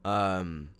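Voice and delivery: usual speaking voice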